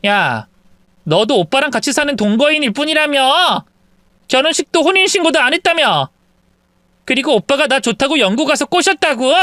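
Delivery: put-on snippy young woman talking back; defiant mistress. Mistress telling wife she's only a live-in partner, defiant. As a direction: throw it back in her face.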